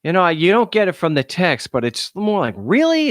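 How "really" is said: "Really?" pitched high in sarcastic disbelief; mock disbelief, drawn up high. Shown as a demonstration. Explaining his tone to viewers, sarcastic high "Really?". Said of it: "It's a sarcasm thing".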